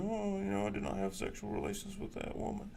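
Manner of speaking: put-on high, soft Southern Clinton accent; Bill Clinton impression. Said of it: "great accent of Bill Clinton".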